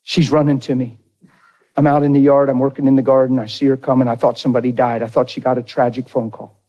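ordinary talk, nothing marked

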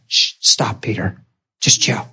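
soft, low hush to a disciple. Retold or imitated. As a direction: quietly shush him, voice dropped. Jesus hushing Peter in retelling.

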